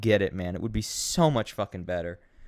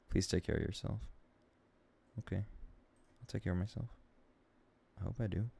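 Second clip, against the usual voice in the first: very soft, low and downcast; quiet, low, deflated; subdued; 3 semitones lower, 15 dB quieter than usual. Real, not acted. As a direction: say it small, almost to yourself. Reads kind message, answers subdued.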